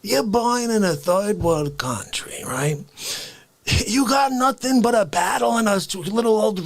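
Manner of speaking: an indignant Bronx café regular demanding respect; New York accent, outraged, pitched high; Face character. Acted. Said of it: "Face appears".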